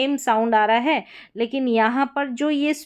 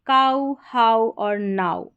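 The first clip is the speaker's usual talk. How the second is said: slow model words; slower than usual. Shown as a demonstration. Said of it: "आउ करके sound".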